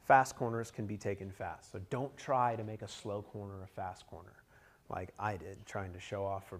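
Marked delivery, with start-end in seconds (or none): none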